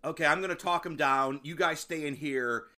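mock calm. Dan planning to talk him down.

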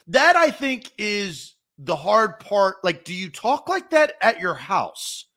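ordinary talk

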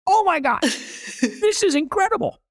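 high, laughing, blown away; amazed delight. Retold or imitated. Retelling his first bite of calamari, high and delighted. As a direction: gush through a laugh.

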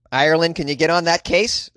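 ordinary delivery

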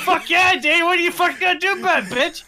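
mock yell. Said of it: "hollering, hooting, screaming".

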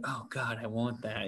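soft, hushed longing; longing sigh. Retold or imitated. Fan swooning over a couple, soft longing sigh. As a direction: breathe it out softly, wanting it. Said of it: "you're like".

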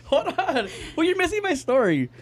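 high-pitched, laughing objection; laughing protest. Real, not acted. Storyteller trying to get back to his story, laughing protest.

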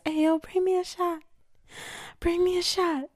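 high-pitched playful holler; mock calling out. Sick host jokingly demanding a drink, high mock call.

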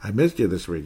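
normal talking voice

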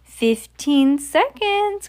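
very slow, high countdown cry. A narrator's reading. Grand High Witch counting down, high drawn-out cry. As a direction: draw the words right out on a high pitch. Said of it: "cried the witch".

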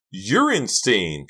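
loud, high, drawn-out shriek; furious shriek. A narrator's reading. Karina turns on Delia, furious shriek. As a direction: shriek it back in fury. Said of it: "Karina shrieked in fury".

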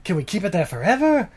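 high, scheming mad doctor; mad scientist voice. Retold or imitated. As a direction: muse like a giddy mad scientist.

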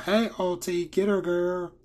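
high, loud hammed-up cheerleader squeal; cheering switch. Nami flips sides and cheers Alti on, high hammed-up squeal. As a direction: suddenly egg her on like a hyped best friend. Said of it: "Nami immediately switches".

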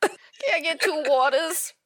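teasing echo, lifted in pitch; mock imitation. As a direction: playfully copy the accent on "waters". Mimicking the friend's drive-through order.

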